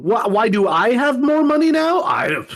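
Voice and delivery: high, feigned surprise at the news; faux-innocent gasp over the inheritance; mock innocence. Acting a killer's fake innocence.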